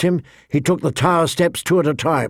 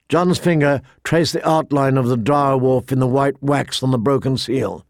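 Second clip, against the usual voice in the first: usual speaking voice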